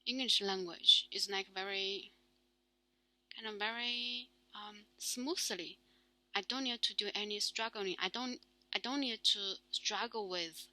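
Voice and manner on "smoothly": normal talking voice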